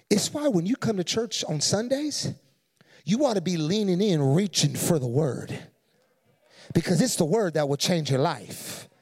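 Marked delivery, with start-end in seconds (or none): none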